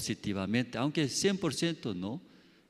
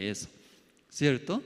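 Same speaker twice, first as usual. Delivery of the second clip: usual speaking voice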